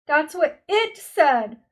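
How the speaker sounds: loud, high, slow cry. A narrator's reading. Meg cries out in panic at the beast's words. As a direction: cry it out in alarm. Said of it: "Meg cried".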